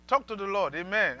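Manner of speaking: normal talking voice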